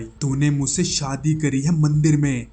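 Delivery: shouting. Retold or imitated. Wife threatening fiancé on the phone, shouting. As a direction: yell the threat at him. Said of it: "Nikki जोर से चिल्लाई".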